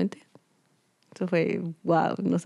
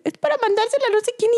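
mom's voice; faster than usual. Retold or imitated. Quoting mother.

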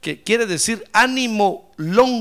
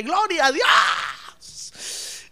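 high, very drawn-out exclamation; retold praise cry; 8 semitones higher, much slower than usual. (alabanza exclamada y alargada)

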